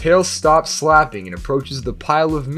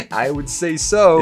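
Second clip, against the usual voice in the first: normal talking voice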